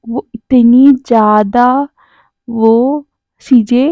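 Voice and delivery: slow, stretched teasing words; lingering mock suspense; drawn-out teasing. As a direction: drag it out to tease. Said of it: "और कह रहा था".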